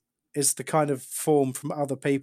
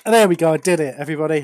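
triumphant; 2 semitones higher, 9 dB louder, faster than usual. Real, not acted. Jar finally opens, triumphant.

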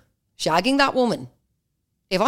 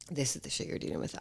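hushed, much softer conspiratorial whisper; a knowing whispered aside; 2 semitones lower, 13 dB quieter than usual. Real, not acted. Aside to listening girls.

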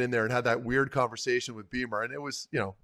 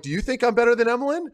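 higher, louder and fast comeback to the GM; fast, raised "do you think I'm better than Emelin" thrown back at the GM; retorting; 8 semitones higher, 5 dB louder, faster than usual. Retold or imitated. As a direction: fire the question back quickly, a bit riled up. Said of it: "I'm like".

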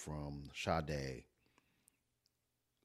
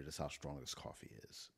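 ordinary talk, nothing marked

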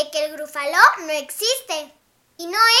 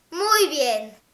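ordinary delivery, nothing marked

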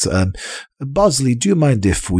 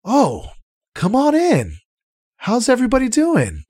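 much higher, hearty welcoming innkeeper; jolly innkeeper. Acted. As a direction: beam at the guests as you greet them. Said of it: "he smiles and welcomes you in and goes".

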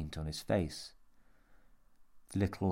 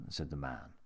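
usual speaking voice

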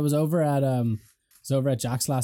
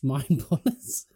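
mock dramatic; much slower than usual. Echo of slow phrase.

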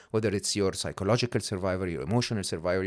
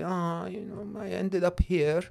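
put-on higher, childish plea of a lost little kid; whiny six-year-old; 9 semitones higher than usual. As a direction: whine like a lost child calling for mommy.

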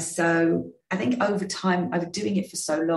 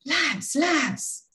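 much higher, imitating a Gloucestershire rugby player's accent; a self-mocking attempt at a Gloucestershire burr; 12 semitones higher than usual. The captain calling the lads in a Gloucestershire accent. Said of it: "best Gloucestershire accent".

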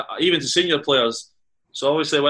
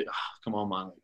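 retold reluctant plea, much softer and lower; mock-weary sigh of protest, under the breath; reluctant groan; 6 semitones lower, 13 dB quieter than usual.

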